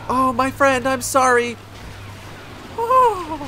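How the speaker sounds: high, loud wail of regret; loud, distressed apologetic cry; dismayed apology. Real, not acted. Hit a teammate by mistake.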